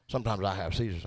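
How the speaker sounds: very low, hushed repeat; drunk dude. Retold or imitated. Drunk man repeats his condition to comic, low hush. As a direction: say it low and barely audible. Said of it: "Drunk dude was there".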